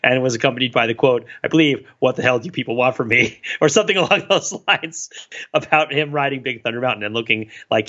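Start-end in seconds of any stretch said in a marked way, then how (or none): none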